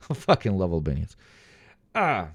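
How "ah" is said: ordinary delivery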